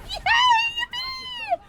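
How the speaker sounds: very high, loud squealed cheer; gleeful cheering. Real, not acted. Gamer celebrating a moment in play, gleeful shriek.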